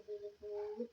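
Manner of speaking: whispered to the cat; soft whispered pet name. Real, not acted. Whispered end of song to cat.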